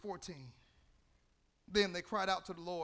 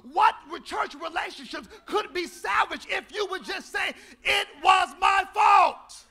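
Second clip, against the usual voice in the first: preaching shout; 12 semitones higher, 18 dB louder than usual. Acted.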